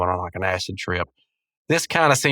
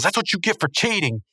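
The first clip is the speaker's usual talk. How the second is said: higher-pitched cartoon taunt; mock scolding; 7 semitones higher than usual. Punching Rigby for breaking the rules, lightly mocking scold. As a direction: imitate someone gloating over a punishment. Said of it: "and he goes".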